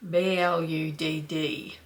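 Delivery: B L U D D slowly, letter by letter; drawn-out letters B L U D D, teaching the local way; spelled-out Aussie pronunciation. Shown as a demonstration. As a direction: spell out B L U D D low and deliberate. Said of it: "we enunciate it".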